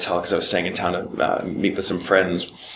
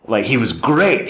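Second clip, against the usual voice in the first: usual speaking voice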